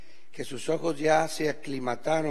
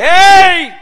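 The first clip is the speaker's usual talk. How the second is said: very loud, high, slow yell; Jesus shouting; 20 dB louder, much slower than usual. Retold or imitated. Jesus yelling at scared disciples, slow and high. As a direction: call out over frightened men. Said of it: "les grita y les dice".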